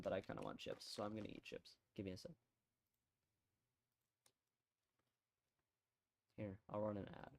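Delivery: quiet mumble. Real, not acted. Muted mic before eating chips.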